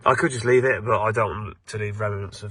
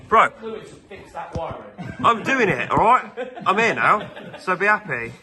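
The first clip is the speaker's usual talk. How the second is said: annoyed loud retort; 9 semitones higher, 4 dB louder than usual. Real, not acted. Retorts to friend nagging him.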